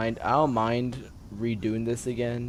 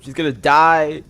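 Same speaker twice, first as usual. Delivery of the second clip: sudden loud, higher cry of alarm; alarmed shout; 5 semitones higher, 11 dB louder than usual. Real, not acted. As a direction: yell it as she goes over the edge. Panicking as character nears cliff.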